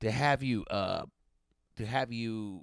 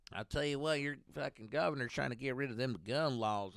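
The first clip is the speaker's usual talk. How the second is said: usual speaking voice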